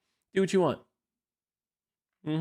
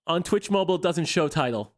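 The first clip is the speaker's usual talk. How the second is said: ordinary delivery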